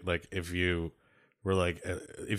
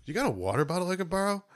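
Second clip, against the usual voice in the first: mimicking friend; 10 semitones higher, 4 dB quieter, faster than usual. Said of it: "He's like".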